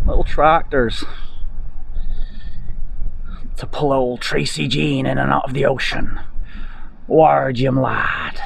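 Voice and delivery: put-on West Country sailor lilt; hammed-up West Country burr; West Country accent. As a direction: play at a West Country old salt. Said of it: "putting on the West Country accent".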